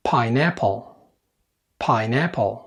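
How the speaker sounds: "باينابل" as English pineapple, with p's; English P sound. Shown as a demonstration. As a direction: puff both p sounds in "باينابل". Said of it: "فيها صوت من الاتنين".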